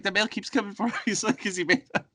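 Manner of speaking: high, bewildered frantic voice; high mock bewilderment.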